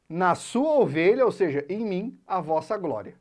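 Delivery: usual speaking voice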